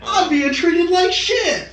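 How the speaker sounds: mock him playing the poor victim, high and slow; whiny victim. Mocking Logan's victim video, high whiny voice. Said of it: "it's like".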